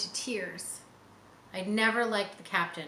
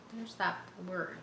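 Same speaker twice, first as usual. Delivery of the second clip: plain reading voice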